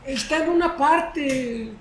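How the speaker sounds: high, slow, vague answer; unsure believer. Acted. Congregant asked where the passage is, vague and high. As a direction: mimic someone stalling for the answer. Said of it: "Hay personas que dicen".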